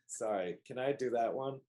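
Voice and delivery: ordinary talk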